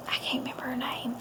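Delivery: hushed, voiceless aside under the breath; whispered aside. Real, not acted. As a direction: whisper it to yourself. Aside on a forgotten name.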